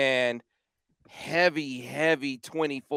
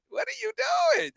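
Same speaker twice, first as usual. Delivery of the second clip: high, shouted disbelief; exasperated shout; faster than usual. Acted. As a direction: yell it at the coach in disbelief. Exasperated at coach's fourth down.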